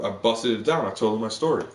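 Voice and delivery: ordinary talk, nothing marked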